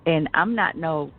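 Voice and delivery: ordinary talk, nothing marked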